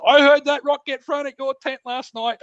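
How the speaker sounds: imitating his mate. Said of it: "he says".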